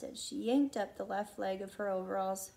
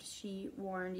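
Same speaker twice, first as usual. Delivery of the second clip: plain reading voice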